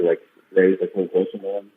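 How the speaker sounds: ordinary talk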